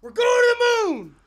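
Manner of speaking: rally the crowd; crypto bro shout. Acted. Crypto hype chant.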